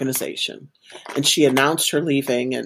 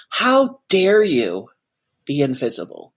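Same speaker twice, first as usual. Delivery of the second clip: stern reproach; 4 semitones lower than usual. Retold or imitated. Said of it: "of course it sounded like this".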